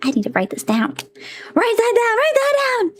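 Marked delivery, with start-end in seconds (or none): 1.5-2.9 high excited repeat; rising excitement, say it twice. Real, not acted.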